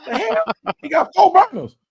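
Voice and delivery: high, startled "The hell?"; retold disbelief. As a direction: act stunned at what comes out of the trunk. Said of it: "I'm like".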